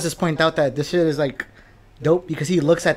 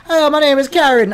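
high, loud put-on Caribbean greeting; Caribbean accent; 9 semitones higher, 5 dB louder than usual. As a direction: mimic a coworker slipping into a Caribbean accent. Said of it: "a Caribbean accent".

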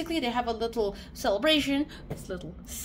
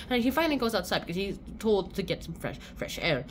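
ordinary delivery, nothing marked

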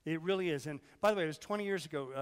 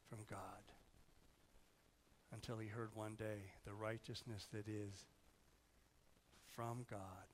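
hushed sermon storytelling; 5 semitones lower, 11 dB quieter than usual. Real, not acted. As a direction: tell it quietly, letting each phrase settle. Hushed telling of Luther's story.

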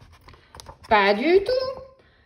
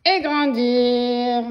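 slow and louder, stretched wide; stretched out big; 6 dB louder, much slower than usual. Acted. Text growing bigger, slow loud stretched words. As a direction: draw it out huge as the text grows.

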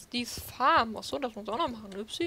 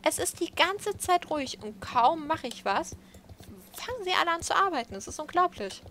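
higher and louder, exasperated complaining; annoyed rant; 6 semitones higher, 6 dB louder than usual. Real, not acted. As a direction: vent at the noisy workers outside, fed up. Streamer annoyed by noisy workers outside.

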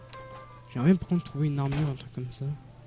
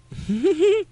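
high squealing laugh; 9 dB louder than usual. Real, not acted. Reacts to game moment.

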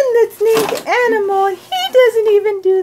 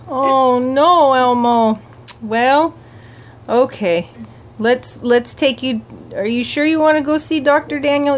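deep puppet voice; 10 semitones lower than usual. Pretend. Puppet worrying about Elmo seeing the doctor, low. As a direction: sound like a big gentle puppet worried for Elmo.

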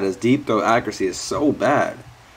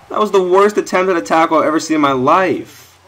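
raised, loud complaint; real annoyed outburst; indignant rant; 6 semitones higher, 5 dB louder than usual. Real, not acted. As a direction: vent the frustration. Ranting about bad tackle.

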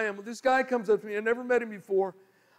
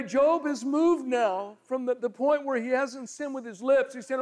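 usual speaking voice

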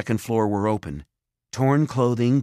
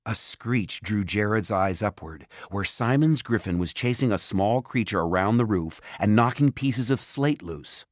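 usual speaking voice

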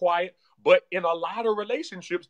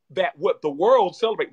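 ordinary talk, nothing marked

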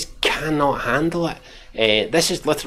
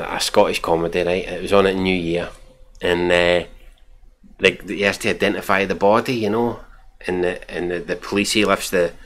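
normal talking voice

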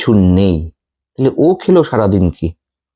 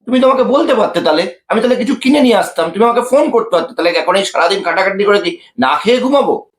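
much higher, scolding; angry complaint; 8 semitones higher than usual. Acted. Husband scolding wife over no dinner, angry and high. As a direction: snap at her for not calling. এত রাগ করছো কেন?.

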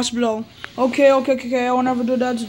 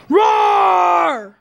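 long, high, shouted roar; scary monster roar; 5 semitones higher, 4 dB louder than usual. Pretend. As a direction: roar to frighten them. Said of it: "let me scare them".

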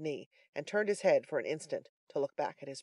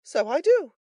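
high, loud character voice; frank third man; 10 semitones higher, 10 dB louder than usual. A narrator's reading. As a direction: own up to it readily.

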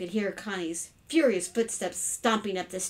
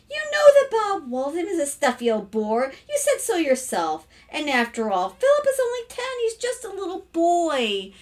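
higher-pitched, coaxing protest; pleading defence; 9 semitones higher, 5 dB louder than usual. Acted. Mother defending her young son to his father, pleading.

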